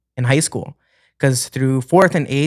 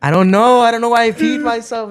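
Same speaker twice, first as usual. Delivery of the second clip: put-on whiny kid; 9 semitones higher, 3 dB louder than usual. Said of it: "And I was like".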